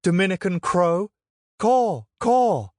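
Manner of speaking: high, loud mocking jeer; mock the friar as a crow; taunting heckle. A narrator's reading.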